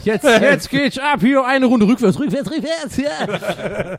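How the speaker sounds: giddy squealing cheer; mock excitement. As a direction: ham up the hype like a party starting. Hyped joke, excited squeal.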